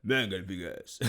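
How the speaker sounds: Rocky impression. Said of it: "He used to talk like Rocky 'cause his mouth".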